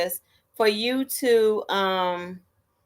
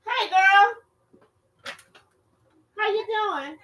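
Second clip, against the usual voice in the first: far from the mic, a bit higher; off-mic chat; 8 semitones higher than usual. Real, not acted. Greeting a friend at the door, off mic. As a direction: talk to a visitor away from the mic.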